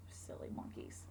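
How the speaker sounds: soft unvoiced whisper; whispered aside. A narrator's reading. Storyteller whispering to the children about the monkeys. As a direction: lean in and whisper it to the kids. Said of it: "aside whisper to children".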